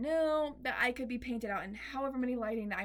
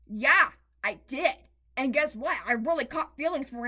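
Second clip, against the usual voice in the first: loud admission; 2 semitones higher, 11 dB louder than usual. Real, not acted. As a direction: own it out loud. Emphatic admission, much louder.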